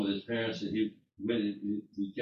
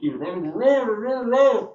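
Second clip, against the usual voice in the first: high, loud mock yell across the room; retold yell; 12 semitones higher, 11 dB louder, slower than usual. Coworker shouting across the room, retold as a loud high yell. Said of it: "she was yelling at me across the room".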